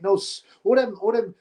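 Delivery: normal talking voice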